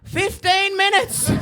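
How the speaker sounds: high, slow broad Australian drawl; slow, high broad Australian deadpan; broad Aussie bloke. Retold or imitated. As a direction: stretch it out like an outback cop. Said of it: "he goes".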